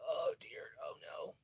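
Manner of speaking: soft unvoiced whisper of worry; hushed oh dear, oh no; whispered dismay. Real, not acted. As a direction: breathe the words out in a hush. Recalling shock after cops came.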